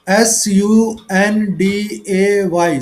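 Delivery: letter by letter, slow and clear; spelled out slowly. Shown as a demonstration. Teacher spells the word for students, slow and clear. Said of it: "ऐसे बोलना, ऊंचा बोलना".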